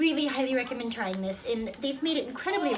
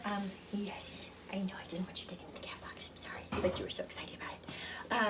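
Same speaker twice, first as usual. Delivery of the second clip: whispered apology; 3 semitones lower, 11 dB quieter than usual. Real, not acted. Mom whispers sorry to her baby kept from the cat box. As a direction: soothe him under your breath, off-mic.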